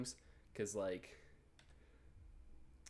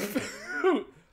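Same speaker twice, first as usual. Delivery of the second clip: a sudden high, loud laugh; burst of laughter; 11 dB louder than usual. Real, not acted. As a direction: crack up in shock. Reacting to 'I'm pregnant' text.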